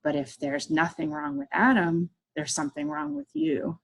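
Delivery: tiny needling inner voice, lightly done; mini self on shoulder. Retold or imitated. Little self on the shoulder answering back, lightly voiced inner voice. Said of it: "this other little mini [name] popped up".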